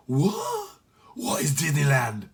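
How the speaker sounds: puzzled Jamaican pirate; confused Jamaican sailor asks; baffled Jamaican accent. Acted. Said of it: "The Jamaican".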